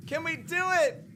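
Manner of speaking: almost shouting the question; rising suspense. Real, not acted. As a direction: push the tension up on the ask. Final hit pending.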